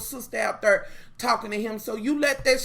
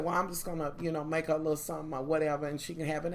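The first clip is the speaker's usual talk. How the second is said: quick, softer, faking innocence; mock sweet calm; 5 semitones lower, 7 dB quieter, faster than usual. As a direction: breeze through it sweetly, like nothing's wrong. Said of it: "I'd have played it so motherfucking calm".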